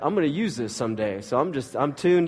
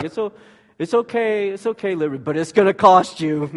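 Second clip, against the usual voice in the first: big sister voice; 7 semitones higher, 6 dB louder than usual. Retold or imitated. And she's like.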